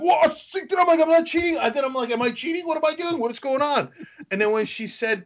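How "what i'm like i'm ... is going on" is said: panicked confusion. Retold or imitated. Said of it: "I was like".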